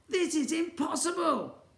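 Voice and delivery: high wail of despair; wailing boy. Acted. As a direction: wail it like a hopeless kid. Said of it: "the boy wailed".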